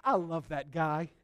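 low, soft aside to a pal; confiding delight. Retold or imitated. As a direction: confide it fondly, keeping it down. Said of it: "he leans over and gives Enoch a, a elbow".

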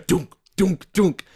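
mouth-made knocking sounds; knocking noise. Pretend. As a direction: knock on the glass with your voice. Imitating knocking on screen.

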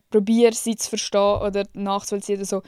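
ordinary talk, nothing marked

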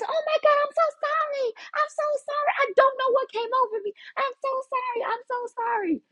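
very high, squeaky frantic sorry; panicked apology. Retold or imitated. Jenny panicking to her coworker after slipping again, squeaky frantic. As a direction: shriek out apologies in a tiny voice.

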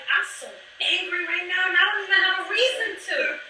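half-laughing about her own baffling fury; laughing mock anger. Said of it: "I was like".

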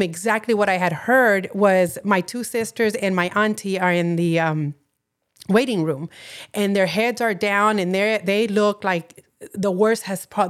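usual speaking voice

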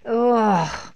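loud, falling groan; exasperated groan. A narrator's reading. Mum groans as all the children invade her bath, loud groan. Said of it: "Missus Large groaned".

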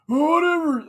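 high, loud mocking brush-off; loud sneering whatever; dismissive whatever. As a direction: toss it off like a jerk. Rude guy dismisses him.

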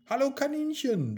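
high-pitched, friendly hello; friendly greeting. A narrator's reading. Mia catches up with a rabbit, greeting it high. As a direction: greet a little animal in a high voice.

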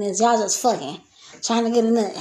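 ordinary talk

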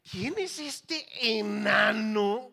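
loud, high, drawn-out challenge; defiant scorn. Acted. Preacher plays David facing Goliath, loud scornful defiance. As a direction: belt out the insult slowly, stretching it.

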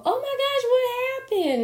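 very high, gasping "oh my gosh"; mock shocked relative. A relative reacting to news of the breakup, mock shock. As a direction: play a shocked nosy aunt.

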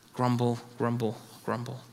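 low muttered grumble, grumble, grumble; imitated grumbling mutter; mock grumbling. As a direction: grumble it low under the breath like a sulky complainer. Mocking grumble sound.

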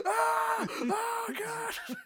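high mock screams of men on fire; burning villains screaming.

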